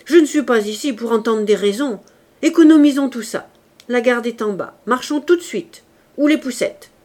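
loud, high, impatient orders; furious. A narrator's reading. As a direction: bark orders, done with arguing. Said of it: "cria Javert furieux".

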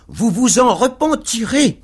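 high-pitched, winded warning; panting threat. A narrator's reading. Pickwick warns his attackers, panting. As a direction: threaten them as if out of breath.

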